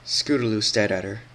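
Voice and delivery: usual speaking voice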